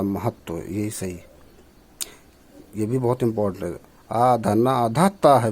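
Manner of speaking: ordinary talk, nothing marked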